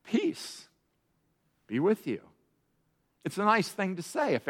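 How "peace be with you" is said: soft, hushed Peace be with you; calm the frightened disciples; gentle greeting. Retold or imitated. Jesus greeting terrified disciples, softly. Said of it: "And Jesus says".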